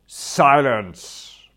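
loud, drawn-out command; officious bellow. Acted. Court official calling for order, officious loud bellow. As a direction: bellow for order, stretching the word. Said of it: "bellowed some officious blighter".

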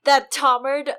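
milk it like a conspiracy reveal; mock dramatic. Podcaster dramatizes a stabbing to her co-host, mock dramatic.

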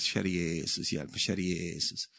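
lightly mimicked pious prayer, low and soft; a hint of low, dutiful piety; religious praying. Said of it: "kört med liksom re-, re-, religiös".

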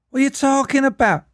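high-pitched botched American turning Scouse; squeaky mock Scouse accent; accidental Scouse. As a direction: attempt American and slip into Scouse. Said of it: "what I went Scouse".

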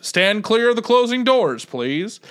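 a hint of a train conductor's call; subway announcement. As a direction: say it like a metro intercom. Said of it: "Like that".

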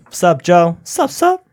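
high, loud sup shout; high excited greeting. Acted. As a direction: squeal it up high at Joe. Greeting Joe Kelly.

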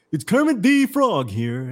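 lightly voiced frog puppet; a hint of a high, slow Kermit. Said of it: "Billy Ray's sorry excuse for a Kermit the Frog impression".

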